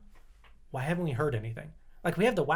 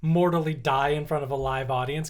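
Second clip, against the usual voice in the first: ordinary talk, nothing marked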